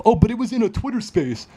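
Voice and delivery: high-pitched mocking whine of an objection; mock objector. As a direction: parody a doubter in a silly high voice.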